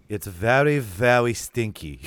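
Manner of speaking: a light mock German accent, drawn out slowly; slow, hammed-up German-accented whine. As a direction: imitate the kid's thick German accent, slowed down. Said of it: "in this, like, thick German accent".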